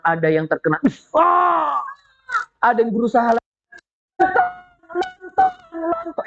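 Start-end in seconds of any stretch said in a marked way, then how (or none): 1.1-1.8 high mock cry of being hit; acted scream. Storyteller plays a man hit by a stone, mock scream. Acts a soldier struck by stone. 4.2-6.2 very high squealed shout, fading; comic falsetto panic; mock cry for help. Storyteller garbles fleeing soldiers' plea for help, squealed mock cry. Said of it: "Mereka sambil teriak".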